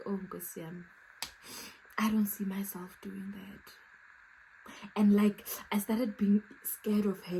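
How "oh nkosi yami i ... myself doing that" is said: much softer, a hushed whisper; awed whisper. Retold or imitated. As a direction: whisper it as if she might hear you. Awed fear of the fearless lady.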